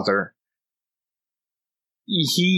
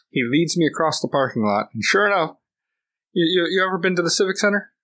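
normal talking voice

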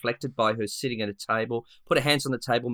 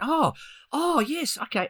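high, delighted oh of recognition; light up as the name clicks; excited recognition; 13 semitones higher than usual. Retold or imitated. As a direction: carer recalling the moment the song was named, excitedly. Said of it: "And I was excited".

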